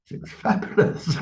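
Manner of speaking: breathy laughing through the words. Real, not acted. To the host about her wild past, laughing. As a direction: laugh it out, delighted and defiant. Defiant reply, laughing at medium's verdict.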